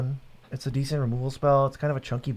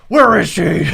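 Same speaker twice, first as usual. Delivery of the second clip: Batman voice; 4 semitones higher, 12 dB louder, slower than usual. Acted. Batman's growled interrogation quote.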